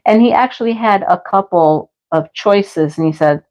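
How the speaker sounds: ordinary talk, nothing marked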